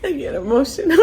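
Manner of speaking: real teary laugh, voice breaking high; half laughing, half crying, and loud; laughing through tears. Real, not acted. Mother tearing up on camera for her baby, laughing through tears. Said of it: "I'm getting too emotional".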